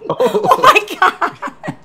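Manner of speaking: high, very slow laughing drawl; cracking up at a friend's joke; laughing oh my God. Real, not acted. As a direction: stretch it out while laughing. Reacting to grandma joke.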